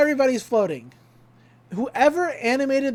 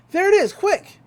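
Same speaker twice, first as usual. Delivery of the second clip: urgent call; 10 semitones higher, 6 dB louder than usual. Retold or imitated. Kids spotting the machine.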